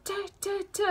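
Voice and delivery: imitated heel clicks, pitched high; high, separate "ta" footsteps; clicking high heels. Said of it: "like high heels kind of thing".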